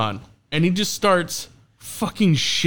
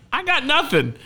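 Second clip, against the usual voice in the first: high-pitched retold protest; 12 semitones higher than usual. MC recalls being roasted with nothing ready, protesting. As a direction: plead your case high up in the voice. Retells being caught unprepared.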